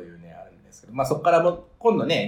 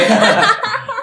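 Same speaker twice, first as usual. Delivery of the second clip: burst of laughter; 9 dB louder than usual. Real, not acted. Laughing at the kids' fan club.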